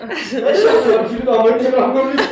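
loud, high, through laughter; real laugh breaking into the words; laughing accusation. Real, not acted. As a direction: blurt it out laughing hard. Joking accusation over a broken toy.

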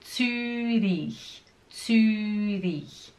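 ZÜRICH slowly, the Ü long and rounded; pouted Ü. Shown as a demonstration. Teacher repeating for learners, drawn-out pouted Ü. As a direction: hold the pouted vowel in "Zürich". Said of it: "tem que fazer esse biquinho".

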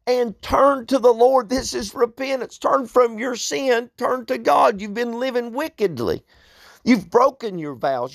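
high-pitched call to repentance; insistent, high preacher's appeal; urgent preaching. Acted.

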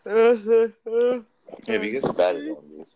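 squeaky high mock taunt. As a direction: taunt them in a silly high voice.

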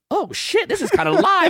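high, surprised "oh shit, this is kinda live"; surprised delight. Retold or imitated. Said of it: "all of a sudden he's like".

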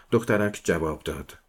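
ordinary delivery

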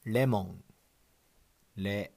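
"lemon" as re-mo-n with a flap r and a nasal end; レモン; Japanese pronunciation. Shown as a demonstration. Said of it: "the first is".

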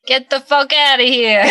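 a punchy New York "Get the fuck outta here"; New York accent. Shown as a demonstration. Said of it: "favorite phrase in a New York accent".

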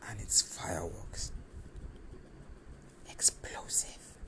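slow breathless whisper; awed whisper. Acted. Describing their first kiss, hushed awed whisper. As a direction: breathe the words out in wonder.

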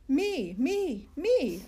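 high, loud eager shout of me me me; shouting eagerly. A narrator's reading. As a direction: call out like a kid desperate to go first. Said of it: "I shouted".

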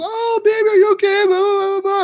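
very high, cooing mock baby talk; fussing parent. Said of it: "I'm never the parent goes".

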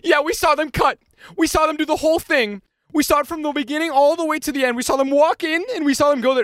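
high, loud nagging tattle; whiny tattletale woman. Retold or imitated. Busybody woman snitching to staff, high and loud. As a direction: mimic a shrill busybody.

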